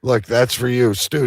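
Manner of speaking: ordinary talk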